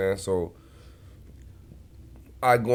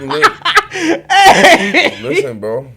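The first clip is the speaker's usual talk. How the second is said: laughing; 12 dB louder, slower than usual. Real, not acted. Laughing mid-story.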